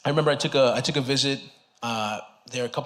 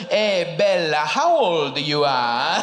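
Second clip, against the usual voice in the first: put-on Italian accent; 2 semitones higher, 3 dB louder, much slower than usual. Impression of Italian pizza man.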